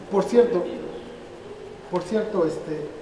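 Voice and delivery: normal talking voice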